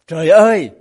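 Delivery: loud anguished cry to heaven; desperate cry. Retold or imitated. Pastor crying out to heaven in despair, loud anguished cry. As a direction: wail it out at the end of your rope. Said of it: "một vị mục sư nói như thế này".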